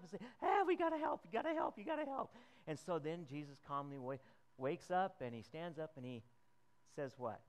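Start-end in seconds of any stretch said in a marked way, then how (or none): 0.4-2.3 much higher, loud desperate pleading; frantic plea. Acted. Disciples waking the sleeping Jesus, frantic high plea. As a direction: beg him to wake up, frantic.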